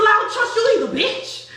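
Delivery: high, loud, laughing through the quote; laughing mock retort. Narrator laughing as she quotes Crystal's retort. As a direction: crack up while snapping back. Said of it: "She just said out of nowhere".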